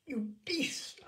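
rising furious cry; cried out in fury. Acted. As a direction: spit it at the drunk husband. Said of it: "I cried".

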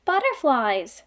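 ordinary talk

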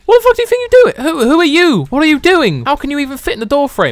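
high, loud alarmed outburst; shocked yell. Real, not acted. Player confronting a giant brute, shocked high yelling. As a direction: shout in disbelief at the giant. Huge brute bursts into car.

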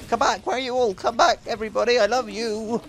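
high-pitched plea to return; mock pleading call. Gamer calling after departing players, mock pleading.

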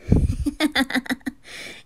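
high run of giggles; amused, rippling little laugh; teasing giggle. Acted. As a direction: giggle teasingly at the young master. Teasing the young master.

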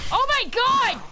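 high, alarmed exclamation; high-pitched startled yell; startled cry. Real, not acted. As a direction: cry out in sudden shock. Startled during game fight.

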